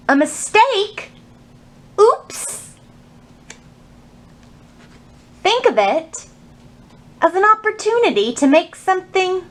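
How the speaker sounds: slow storybook read with long pauses; reading to children. Acted. Librarian reads a picture book to children, slow storytime voice. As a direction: savor each page like storytime.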